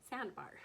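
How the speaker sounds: Sandbar restated with a falling, certain end; firm statement on Sandbar. Shown as a demonstration. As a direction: correct yourself, landing Sandbar as a statement. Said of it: "said that like it was a question".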